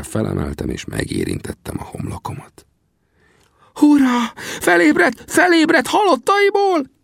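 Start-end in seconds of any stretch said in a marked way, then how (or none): 3.7-6.9 very high, jubilant cheer; overjoyed high-pitched character voice; jubilant Sam. A narrator's reading. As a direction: whoop it out, high and loud. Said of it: "újjongott Sam".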